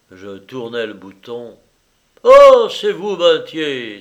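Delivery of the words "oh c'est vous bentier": loud, high cry of greeting; big exclamation, easing slightly on the name; exclaiming. A narrator's reading. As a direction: burst out in surprised welcome. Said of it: "S'écria Lyra".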